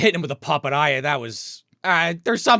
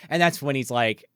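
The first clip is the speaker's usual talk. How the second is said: ordinary talk, nothing marked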